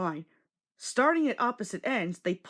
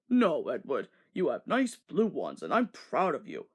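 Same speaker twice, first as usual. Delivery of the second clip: reassuring; 6 semitones lower than usual. A narrator's reading. Fat Controller comforts Edward.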